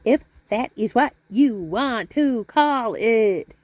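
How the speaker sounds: slow, every word spelled out; slow, careful enunciation. Shown as a demonstration. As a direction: say "that is" slowly and carefully. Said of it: "Learn how to speak".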